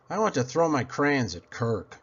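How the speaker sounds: plain reading voice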